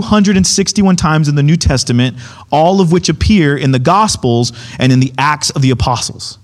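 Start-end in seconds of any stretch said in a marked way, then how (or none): none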